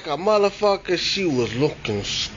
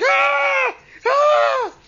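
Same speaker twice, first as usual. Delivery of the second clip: scared screaming. Retold or imitated. Boy running from the ghost, shrieking in panic. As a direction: shriek while running away. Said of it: "I'm like".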